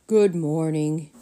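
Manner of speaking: low, slow butler greeting; a slow, low butler's good morning; deep voice. Acted. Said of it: "he said in a deep voice".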